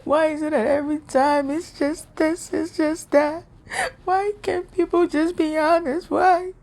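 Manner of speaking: retelling how she sobbed out her questions to God; crying to God. Retold or imitated. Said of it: "I went to God and I cried".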